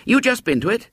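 a keen schoolboy hailing a stranger; eager boy. Acted.

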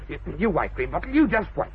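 under-the-breath warning; low hissed aside; muttered threat. Acted.

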